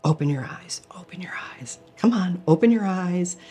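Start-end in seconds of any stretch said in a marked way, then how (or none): 0.0-0.8 urgent breathy whisper, voice dropped out; whispered plea. Retold or imitated. Pleading with her sleeping body, whispered urgently. 1.0-1.8 barely audible whisper, much softer; whispered plea. Retold or imitated. Pleading with her sleeping body, a faint whisper.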